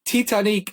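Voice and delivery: Titanic as Tee-tah-NEEK, end stressed; mock Titanic with a long neek; scornful mispronunciation. Shown as a demonstration. Guest rants at the odd film title, scornful Tee-tah-neek. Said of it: "That's not a word".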